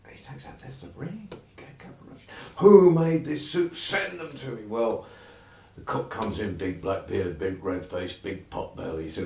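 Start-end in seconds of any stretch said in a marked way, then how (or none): 2.6-4.6 demanding master. Acted. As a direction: summon the cook with booming authority. Master demands who made soup.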